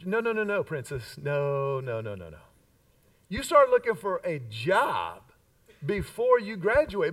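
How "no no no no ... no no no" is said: repeated no sinking low and soft; mock fatherly refusal. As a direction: cut her off, then sink into a low, patronizing no. Father correcting his daughter's imagined plan.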